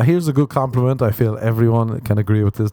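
ordinary talk, nothing marked

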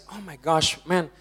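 ordinary delivery

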